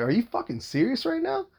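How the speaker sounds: high-pitched retold challenge, rising at the end; incredulous. Father confronting the guy who hit the door, incredulous. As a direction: climb high into an indignant question.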